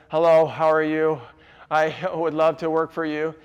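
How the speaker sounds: loud, level-pitched mock greeting; stiff, unfriendly applicant. Shown as a demonstration. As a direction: imitate a disagreeable job seeker on one note. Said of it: "they talk like this".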